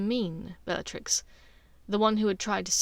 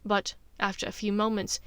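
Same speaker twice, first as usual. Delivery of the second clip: plain reading voice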